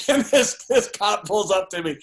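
high, laughing storytelling; tickled by your own story, pitch jumping up. Real, not acted. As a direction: laugh as you start the line. Cracking up at his own story.

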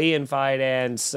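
usual speaking voice

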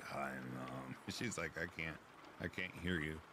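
usual speaking voice

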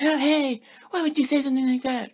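very high, prissy imitation of offended parents; mock scolding parents. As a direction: mimic shocked parents in a shrill put-on voice.

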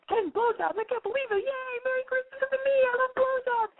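very high, squealed celebration; hammed-up glee, stretching the last words; mock overjoyed boyfriend. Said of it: "he'll be like".

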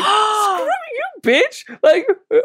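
high-pitched, worked-up telling-off; retold indignant outburst. Teenage book reader telling off the manager who mocked her, indignant. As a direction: snap back at a bully, half in fun.

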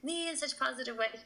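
high-pitched retold fan gush. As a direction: gush to your hero, voice lifted high. Gushing to her idol on meeting.

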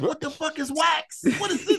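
high, slowed comic disbelief; baffled demand. Retold or imitated. Man calling his friend next day, baffled demand. Retold call asking about wax.

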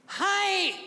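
very high, held squeak; light-as-a-feather voice. Acted. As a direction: make yourself weightless with a high pitch. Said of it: "So I go like this".

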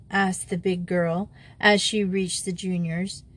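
usual speaking voice

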